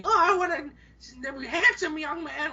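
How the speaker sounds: put-on high laughing old-lady voice; flirty granny. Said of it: "This one granny's hitting on me".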